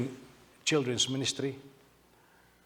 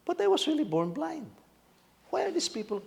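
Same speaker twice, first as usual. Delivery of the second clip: a blind man pleading, much higher; bewildered high protest; 13 semitones higher than usual. Retold or imitated. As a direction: voice jumps high in disbelief.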